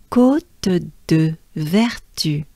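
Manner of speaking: very slow "Côte de Vertu" with spaced syllables; French practice together. Shown as a demonstration. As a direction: lead learners slowly through "Côte de Vertu". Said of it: "let's do it together now".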